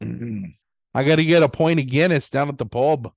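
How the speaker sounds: put-on Boston accent. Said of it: "doing one Boston accent".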